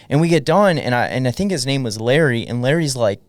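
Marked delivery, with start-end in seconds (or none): none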